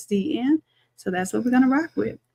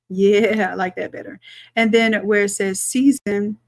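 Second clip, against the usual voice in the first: ordinary talk, nothing marked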